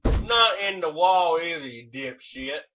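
loud, high yell at brother; angry yell. Real, not acted. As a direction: yell it at him, fed up. Yelling at brother.

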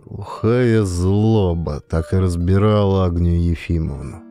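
ordinary delivery

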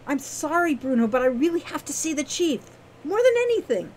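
high, earnest plea; pleading girl. A narrator's reading. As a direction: plead with him, pitch leaping up at the end. Wren pleading with Bruno.

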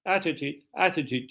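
quiet imitation of American "Attitude"; imitated American pronunciation. Shown as a demonstration. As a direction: softly echo an American "Attitude". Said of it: "Amerikalılar bak farklı okuyor".

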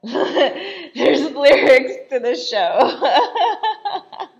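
high, loud words through laughter; laughing. Real, not acted. Viewer amused that the show has lyrics, laughing. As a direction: crack up while talking.

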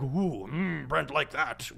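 a lightly voiced, preening inner ego; mock self-satisfied inner voice; his own ego. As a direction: purr the praise like a flattered ego, only lightly put on. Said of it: "my ego is just like".

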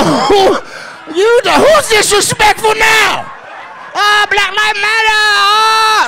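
high, loud wailing shout; hammed-up mimicked lament.

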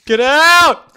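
high, very loud yell; panicked shout. Real, not acted. Gamer scared by a threat, shouting high. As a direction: scream it to drive off an attacker.

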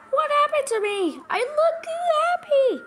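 high, loud wail of mock horror; screaming. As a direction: shriek in hammed-up dismay. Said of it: "I'm screaming".

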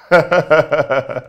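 a short cruel laugh at her; mocking laugh. Husband laughing at his stunned wife, mocking laugh.

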